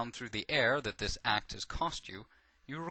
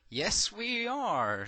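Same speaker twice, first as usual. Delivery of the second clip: high, drawn-out meek sarcasm; 8 semitones higher, much slower than usual. A narrator's reading. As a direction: stretch it out slowly with meek sarcasm. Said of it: "she replies with a meek sarcasm".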